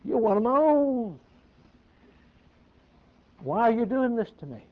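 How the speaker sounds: a high, hurt plea; pleading voice. Acted. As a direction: plead with him like a wounded friend. Voicing Christ pleading with Saul.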